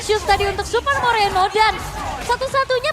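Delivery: loud, high excited call; esports caster. Real, not acted. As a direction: hype up the play. Caster hypes the play.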